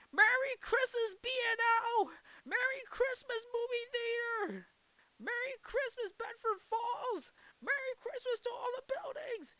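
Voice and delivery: hammed-up glee, hail everything in sight; George Bailey. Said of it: "which he greets with unbounded joy".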